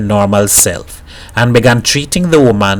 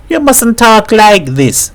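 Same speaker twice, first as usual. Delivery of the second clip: higher, earnest woman defending her man; lightly voiced woman pleading for her husband; pleading Mrs Herrera; 10 semitones higher, slower than usual. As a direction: insist he is good, gently pleading.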